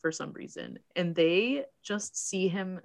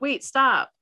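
louder, sharp wait, stop; urgent warning; 6 semitones higher, 6 dB louder than usual. Retold or imitated. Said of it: "Sarah's like".

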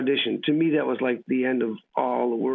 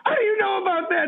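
high-pitched, astonished imitation; retold flipped-out astonishment. Actress flips out at colleague over hometown, astonished, high-pitched. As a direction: act out her flipping out, voice way up high. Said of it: "flipped out 'cause we didn't know".

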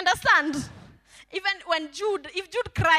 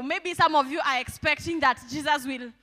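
usual speaking voice

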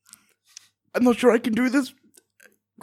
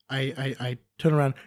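ordinary talk

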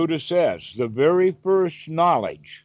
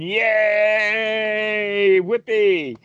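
higher, louder festive whoop; New Year cheer; 5 semitones higher, 3 dB louder, much slower than usual. Retold or imitated. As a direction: cheer like a crowd at midnight. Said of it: "making a lot of noise and cheering".

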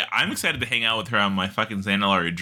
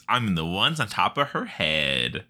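usual speaking voice

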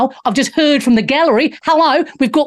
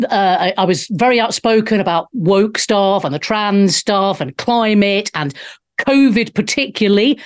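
ordinary delivery

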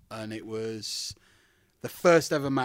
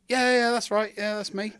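retold self; 9 semitones higher, 6 dB louder than usual. Himself answering in plain British.

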